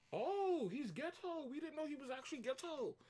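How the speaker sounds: high, hushed mock-surprised voice; astonished onlookers.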